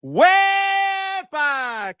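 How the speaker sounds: long, high, loud whoop, stretched out; celebratory holler. Real, not acted. Streamer hailing a super chat donor, celebratory holler. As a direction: belt it out, holding the first syllable. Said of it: "that's not even the loudest".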